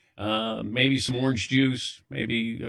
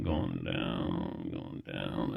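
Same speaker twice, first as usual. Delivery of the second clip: much lower and softer, trailing into a faint; fading away; 11 dB quieter, much slower than usual. Retold or imitated. Acting out his faint for the crew, fading out low. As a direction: sink to a low, fading mock collapse.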